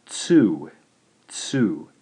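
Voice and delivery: tsu with an initial ts sound; demonstrated pronunciation. Shown as a demonstration. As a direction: model tsu twice, with a pause between. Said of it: "and is pronounced".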